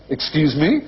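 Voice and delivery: high, with ME lifted questioningly; upspeak. Acted. Retelling a chat in like-speak, mock upspeak. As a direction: end "Excuse me" on an imitated rise. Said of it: "I was like".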